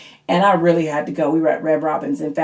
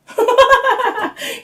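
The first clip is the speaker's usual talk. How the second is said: real, high-pitched cackle; high laughter at the memory; high burst of laughter; 4 dB louder than usual. Real, not acted. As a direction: crack up in a high squeal. Laughs at her son's line.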